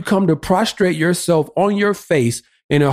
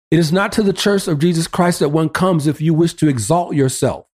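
ordinary talk, nothing marked

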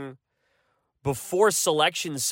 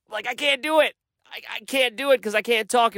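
higher-pitched, pleading mock reply; mock protest; 7 semitones higher than usual. Host answering listeners asking for the next podcast, in mock protest. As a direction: protest that it just can't be done, pitched up. Acting out his reply to messages.

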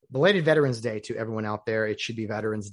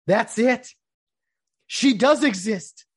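loud, high cry of triumph; elated discovery; 9 semitones higher, 7 dB louder, slower than usual. Acted. Diner finally hearing his dream order exists, elated. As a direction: exclaim it like a long search just ended. Acting finding the perfect order.

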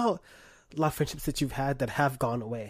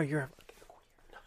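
whispered; 11 dB quieter than usual. Acted. Muttered insult after cough.

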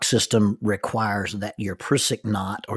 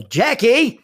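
a high, louder call with a rising end; yell; 12 semitones higher, 8 dB louder than usual. Retold or imitated. Pushing off the tree, yelling for his wife: a high, louder call. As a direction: call out for her again, pushing harder.

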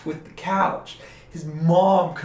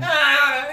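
bratty groan; 10 semitones higher, 5 dB louder than usual. Retold or imitated. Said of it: "he's like".

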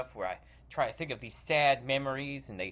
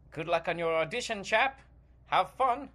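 put-on British accent; 4 semitones higher, 4 dB louder than usual. British actor character.

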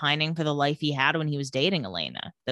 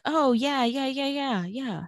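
scared covering up; 6 semitones higher, slower than usual. Retold or imitated. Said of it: "But he's kind of scared".

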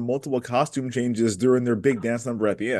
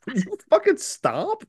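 high put-on exasperated voice; squeaky pleading annoyance; annoyed maid voice; 13 semitones higher than usual.

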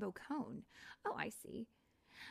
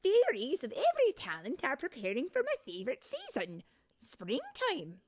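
loud, high excited fairy voice; lightly voiced excited fairy; excited exclaim; 8 semitones higher, 11 dB louder than usual. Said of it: "Bobble exclaimed".

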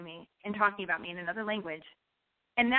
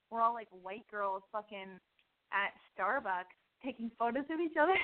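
normal talking voice

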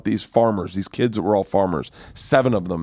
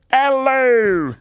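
high, drawn-out mock Irish greeting; bad Irish accent; 4 dB louder than usual. Comedian trying out an accent on his Irish host, botched high Irish. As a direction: have a failed go at an Irish accent. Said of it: "get my Irish accent".

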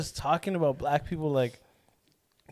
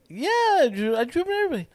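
very high retold reassurance; high-pitched placating; 11 semitones higher, faster than usual. Driver assuring passenger he takes everyone, high-pitched. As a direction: answer in a put-on, sky-high voice.

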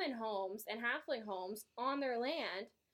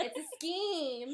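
slow, higher, laughing, "scheme" stretched out; playful scheming; 4 semitones higher, slower than usual. Pretend. Hosts echoing each other about the plot, playful drawn-out reveal. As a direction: draw out "scheme" with mock relish.

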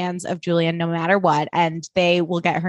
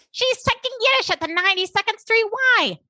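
Cyrus sebagai ayah yang bangga, suara jauh lebih tinggi; imitating Cyrus; 13 semitones higher than usual. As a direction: pamer anak dengan nada tinggi dan manis. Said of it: "And Cyrus is like".

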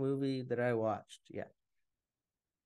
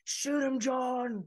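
high urgent call; urgent shout; 8 semitones higher, much slower than usual. Retold or imitated. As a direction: yell it out to your partner. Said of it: "He's like".

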